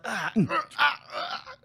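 pained gasps, high; clutching chest, gasping in pain; having a heart attack. Retold or imitated. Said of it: "grabbing his chest. He's like".

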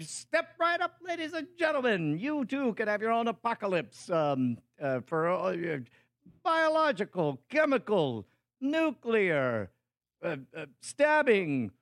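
high, showy sideshow pitchman; loud carnival barker. Retold or imitated. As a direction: hawk it like a fairground huckster. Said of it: "and I'll be like".

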